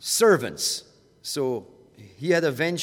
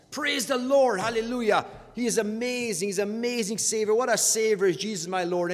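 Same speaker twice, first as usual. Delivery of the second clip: higher, bright burst of glad praise; spontaneous joyful hallelujah from the heart; eager praise; 5 semitones higher than usual. Retold or imitated. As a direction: let the praise spill out gladly. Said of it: "there's a quick response".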